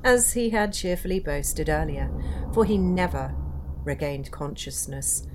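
dark storyteller voice, building tension; ghost story narration. A narrator's reading. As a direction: tell it like a campfire ghost story.

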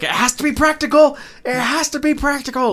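high, loud insistent yell; hammed-up demand from a fussy director; mock director shouting. Director yelling at the effects crew, mock shout. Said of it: "'Cause the director's like".

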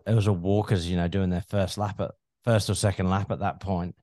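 ordinary delivery

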